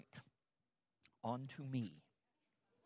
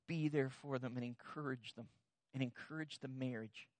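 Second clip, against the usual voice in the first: tearful; 2 semitones lower, 8 dB quieter than usual. Retold or imitated. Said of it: "there are tears in her eyes".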